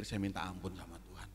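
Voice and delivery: ordinary talk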